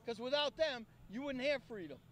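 normal talking voice

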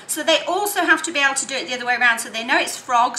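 ordinary talk, nothing marked

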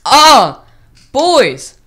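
loud, slow, drawn-out proclamation; triumphant shout. Real, not acted. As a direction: stretch out the milestone like an announcer. Triumphant announcement of milestone.

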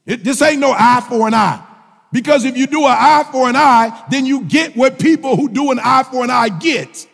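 full-voiced, emphatic sermon delivery; preaching. Real, not acted. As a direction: drive each phrase home to the congregation.

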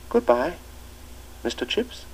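put-on the new boy calling back at the front door; shrill treble. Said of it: "answer came in a shrill treble".

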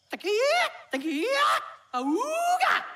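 laughing bird call, high auga. Acted. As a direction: sing the mocker call with a laugh. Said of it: "I'm gonna try again".

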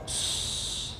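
unvoiced shh to silence them; hushing. Umar hushing the angels, a breathy shh. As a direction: hush the glaring angels.

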